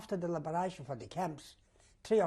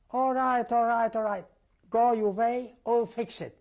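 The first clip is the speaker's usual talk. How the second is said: louder and higher, a mock hasty give-in; hurried appeasing; 5 semitones higher, 7 dB louder than usual. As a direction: wave him off quickly and loudly. Officer backs down fast.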